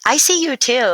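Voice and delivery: warm reassurance. Retold or imitated. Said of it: "I gave her".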